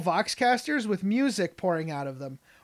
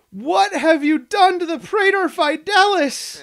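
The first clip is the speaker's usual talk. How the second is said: loud, high, hammed-up indignation; appalled outburst; 10 semitones higher, 9 dB louder than usual. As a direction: bellow it in mock horror at the desecration. Acts out the appalled commander's outburst.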